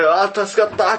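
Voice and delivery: loud, high burst of relief; relieved exclamation from a retold dream; acted relief. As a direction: cry out as if just saved.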